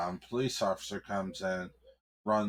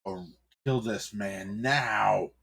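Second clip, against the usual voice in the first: slow commanding order; slower than usual. Acted. Cardinal orders a killing.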